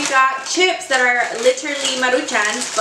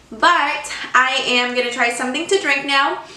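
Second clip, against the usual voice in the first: ordinary talk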